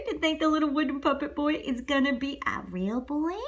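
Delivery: plain reading voice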